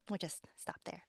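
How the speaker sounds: ordinary talk, nothing marked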